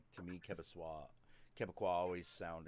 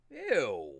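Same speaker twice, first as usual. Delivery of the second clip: loud mock disgust; 5 semitones higher, 10 dB louder than usual. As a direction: recoil from the thought. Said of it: "I'm like".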